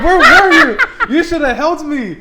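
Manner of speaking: loud, high-pitched indignant outburst; outraged reproach. Retold or imitated. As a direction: shout at a friend who let you down. Scolding the friend who watched.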